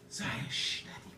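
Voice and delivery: shushing whisper; hush, barely voiced; whispering while hiding. Real, not acted.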